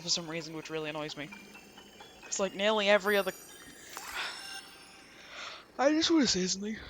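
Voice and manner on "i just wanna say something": talking through a yawn. Real, not acted. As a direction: yawn while saying it. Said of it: "Excuse me, yawning".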